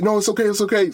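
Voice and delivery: imitating a wife's high-pitched reassurance; urgent reassurance. Wife reassures him after outburst.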